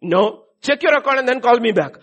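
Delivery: put-on father telling the child to check the account, loud; firm loud retort. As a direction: tell them off firmly. Acted phone quarrel over money.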